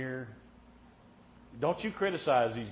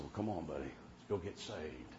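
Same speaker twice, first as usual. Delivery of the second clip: tender; 7 semitones lower, 10 dB quieter than usual. Retold or imitated. Gently urging friend to altar.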